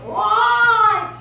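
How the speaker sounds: high, loud yowling why; hammed-up cat yowl; cat cry. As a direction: wail it like a cat. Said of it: "it's just sitting there going".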